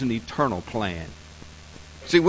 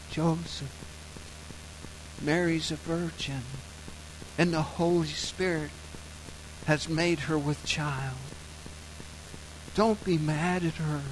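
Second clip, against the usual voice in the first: polite angel; 8 dB quieter than usual. Acted. Angel soothing Joseph in his dream, soft and polite. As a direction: speak gently as if into a dream. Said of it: "I get this polite idea".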